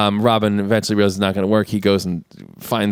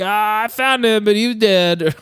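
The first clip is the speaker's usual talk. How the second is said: mock reporting; 9 semitones higher, slower than usual. Will answers the sheriff.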